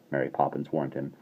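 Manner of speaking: ordinary delivery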